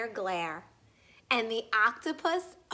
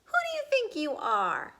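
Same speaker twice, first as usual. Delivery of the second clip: high, sharp outraged cry; indignant cry; 11 semitones higher than usual. Acted. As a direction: fling it out like an insulted show-off. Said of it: "cried the rainbow fish".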